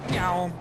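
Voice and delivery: loud "neeow" of something zooming past; vocal flyby noise; imitated whoosh. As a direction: mimic a vehicle shooting by. Imitates vehicle flying past.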